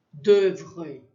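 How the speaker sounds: d'oeuvre with a tightly closed eu vowel; closed French vowel. Shown as a demonstration. Teacher models a hard French word for learners, closed vowel. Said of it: "um E bem fechado".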